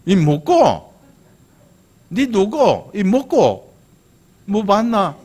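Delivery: high, loud Gyeongsang intonation; exaggerated Gyeongsang accent, pitch jumping high; Gyeongsang dialect. Shown as a demonstration. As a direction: punch out short questions in Gyeongsang-do dialect. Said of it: "경상도 말로 뭐야?".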